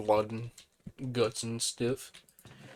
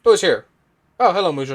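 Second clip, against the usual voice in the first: loud, higher-pitched surprised greeting; calling out; 6 semitones higher, 13 dB louder, faster than usual. Real, not acted. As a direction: call out loudly to a visitor. Calls out to arriving visitor.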